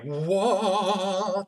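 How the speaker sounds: high, hammed-up gasp of wonder; a mock wow at a sudden idea; mock amazed whoa. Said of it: "it was like".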